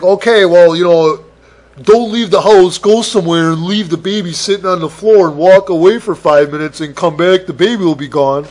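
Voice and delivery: loud, overbearing know-it-all instructions; loud condescending warning. Retold or imitated. Parent warning the babysitter, loud and condescending. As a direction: lecture the babysitter loudly, like they're a fool.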